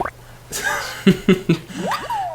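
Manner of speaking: squealing laugh; giggling cackle way up high; high burst of laughter. Real, not acted. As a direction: laugh at the hellhole joke. Laughing at own memory.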